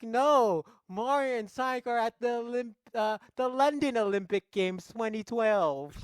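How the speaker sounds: high-pitched mock-earnest hero; hammed-up dramatic explaining; Luigi. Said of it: "And Luigi's like".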